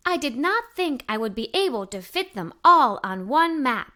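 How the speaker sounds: plain reading voice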